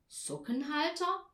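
high rising question; called-out guess. A narrator's reading. Papa guessing Tina's present, calling it out. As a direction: throw out the guess as an exclamation. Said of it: "rief Papa".

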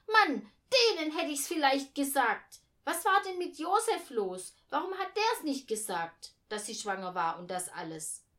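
put-on high, loud child's rant; indignant interjection. A narrator's reading. Girl demands to know why Josef said nothing, loudly indignant. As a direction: cut in loudly, full of outrage. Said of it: "rief Eugenia dazwischen".

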